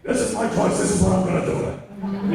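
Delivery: mock-dramatic actor's pronouncement; Al Pacino. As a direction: declare it like a scenery-chewing star. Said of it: "go Pacino on it".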